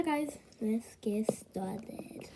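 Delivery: slow whispered cue; hushed slow start. Real, not acted. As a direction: whisper it slowly, drawn out.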